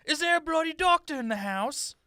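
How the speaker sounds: a hint of cockney, calling for a doctor; lightly cockney prince. The prince calling for a doctor, in mild cockney. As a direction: call for help in mild cockney.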